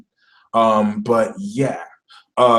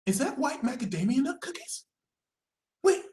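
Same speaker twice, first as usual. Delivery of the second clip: small, high, soft voice; hushed, high-pitched curiosity; tempted; 10 semitones higher, 10 dB quieter than usual. Acted. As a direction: drop the outrage and ask meekly. Righteous Sagittarius suddenly tempted.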